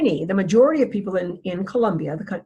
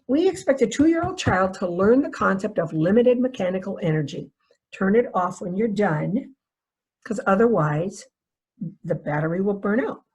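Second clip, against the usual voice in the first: ordinary talk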